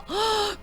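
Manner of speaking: soft frightened gasp; quiet startled gasp; scared gasp. Acted. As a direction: catch your breath in fear. Scout acting scared.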